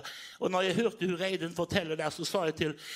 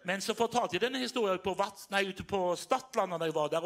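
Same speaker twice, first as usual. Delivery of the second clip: ordinary talk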